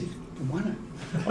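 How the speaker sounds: hushed, slow, breathy secret; whispered aside. Retold or imitated. Mate quietly tipping him off he won, whispered. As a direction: let the mate whisper it under his breath.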